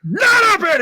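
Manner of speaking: loud, high-pitched roar; explosive mock outburst; imitated angry bellow. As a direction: bellow back like an enraged player. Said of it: "and he goes".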